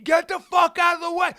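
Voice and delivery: high, loud aggressive order; indignant re-enacted shout. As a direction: bellow someone out of the way. Re-enacting the insult.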